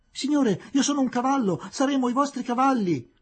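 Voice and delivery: a student offering to pull the carriage; eager shout. A narrator's reading. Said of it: "gridò un altro". (grido entusiasta)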